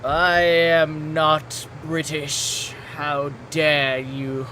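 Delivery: slow hammed-up British accent; over the top slow British voice. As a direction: draw out a posh British protest. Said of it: "you're doing the voice".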